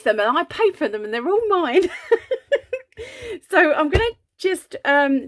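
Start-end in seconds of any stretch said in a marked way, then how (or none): 1.9-3.4 high gleeful giggle. Real, not acted. Crafter giggling over her paid-for powders, gleeful laugh. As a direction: laugh with glee.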